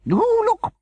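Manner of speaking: very high, loud squeal of delight; delighted cry. A narrator's reading. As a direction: shriek with joy at the find. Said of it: "she cried in delight".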